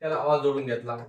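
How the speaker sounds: ordinary talk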